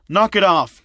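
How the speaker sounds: teacher cutting off the chatter; annoyed. A narrator's reading. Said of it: "visibly annoyed".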